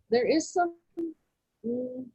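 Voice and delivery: ordinary talk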